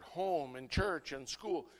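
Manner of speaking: ordinary talk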